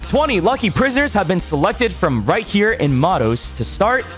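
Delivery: big game show promo voice; booming prize announcer. Acted. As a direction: sell it like a TV trailer.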